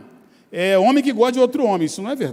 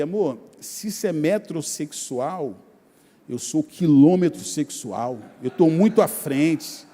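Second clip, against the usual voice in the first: low, slow, self-satisfied husband; mock boasting; 8 semitones lower than usual. Husband joking to his wife about being ahead of metrosexuals, mock boasting. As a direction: drop low and measured for a comic brag.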